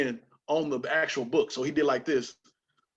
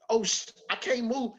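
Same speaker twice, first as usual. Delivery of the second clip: high-pitched retold alarm; mock panic; 9 semitones higher than usual. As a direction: play being stuck and alarmed. Retold panic, stuck facing dog.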